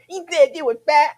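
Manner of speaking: high, loud mock little-kid voice. Little kid telling mama about an insult, high childish voice. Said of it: "talked like that as a little kid".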